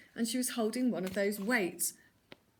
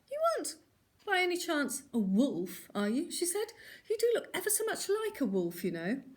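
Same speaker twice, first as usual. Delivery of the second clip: put-on suspicious old lady; 9 semitones higher than usual. A narrator's reading. Granny suspicious of wolf.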